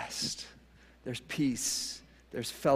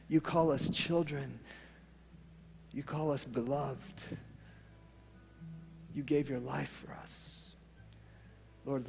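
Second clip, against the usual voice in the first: closing prayer; 8 dB quieter than usual. Real, not acted. Pastor praying over the congregation, hushed and earnest. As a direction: pray quietly as if alone with God.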